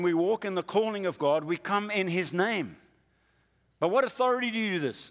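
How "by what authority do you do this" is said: demanding challenge. Retold or imitated. Leaders demanding Jesus' credentials.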